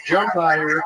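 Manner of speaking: mock heckling. Acting as spectator calling to gymnast.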